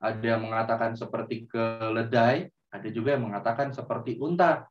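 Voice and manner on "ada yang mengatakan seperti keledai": ordinary delivery, nothing marked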